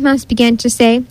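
ordinary delivery, nothing marked

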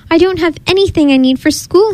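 high-pitched storybook whimper. A narrator's reading. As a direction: whimper like an upset little cub. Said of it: "he whimpered".

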